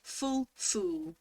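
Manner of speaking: FULL short vowel, FOOL long oo; contrasting pronunciation. Shown as a demonstration. Showing they don't sound the same, contrasting pronunciation. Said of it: "they don't sound the same to me".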